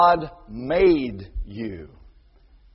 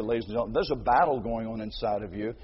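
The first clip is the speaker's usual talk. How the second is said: ordinary delivery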